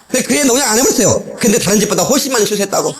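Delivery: ordinary talk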